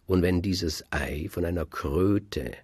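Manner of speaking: ordinary delivery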